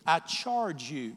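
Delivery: slow, weighty scripture reading; solemn charge. Acted. Preacher charging believers from scripture, solemn. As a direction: draw out each word with gravity.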